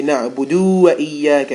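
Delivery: wa pulled onto na'budu, said loud; wrong version. Shown as a demonstration. Teacher warning students off the wrong split. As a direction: show the mistake to avoid. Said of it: "don't say".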